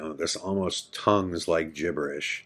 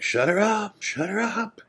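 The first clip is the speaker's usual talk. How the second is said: shrill scream. Retold or imitated. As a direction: screech it like a man who has lost it. Donnie screaming at crying girl.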